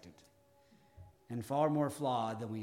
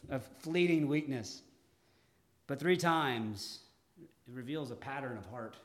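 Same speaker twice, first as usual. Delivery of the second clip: ordinary talk, nothing marked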